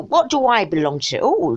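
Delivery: normal talking voice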